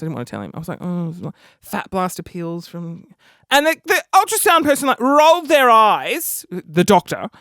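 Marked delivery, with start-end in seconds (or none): 0.8-3.1 low, soft, reluctant admission; embarrassed. Retold or imitated. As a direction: confess it quietly, dragging it out. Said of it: "I was, like, really embarrassed".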